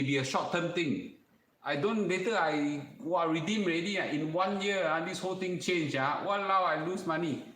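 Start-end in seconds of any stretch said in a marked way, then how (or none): 1.6-7.4 high, fretful Singlish aunty voice. Pretend. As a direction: panicky Singlish complaining, pitched up. Acting a worried Singlish borrower.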